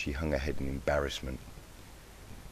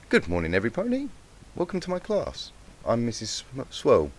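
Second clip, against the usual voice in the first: bright, higher teacher voice announcing to the class; teacher's greeting; 5 semitones higher than usual. Acted. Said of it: "the teacher announced to her eager students".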